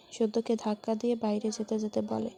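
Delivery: ordinary delivery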